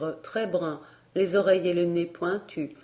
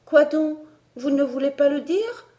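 put-on high, louder eager girl's voice; 8 semitones higher, 5 dB louder than usual. A narrator's reading. Elsie presses the governess, high and eager. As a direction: push for the answer, bursting with curiosity.

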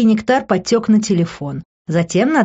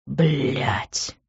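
low, softer groan of defeat; groaned curse; 3 semitones lower, 6 dB quieter than usual. A narrator's reading. Man giving in to her tears, groaned curse. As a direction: groan the swearword under the breath. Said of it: "простонал он".

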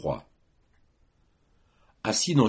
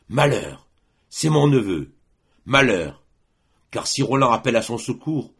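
raised, urgent cry of dismay; alarmed exclamation; 5 semitones higher, 5 dB louder than usual. A narrator's reading. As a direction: cry out as the horn's meaning dawns. Charlemagne recognises his nephew's horn. (exclamation alarmée, voix haute et pressante)

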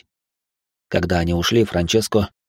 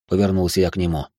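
ordinary delivery

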